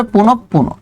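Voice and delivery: পুনঃ পুনঃ as "punop-puno"; correct pronunciation. Shown as a demonstration. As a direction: close the first পুনঃ on a doubled p. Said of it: "কি উচ্চারণ হবে".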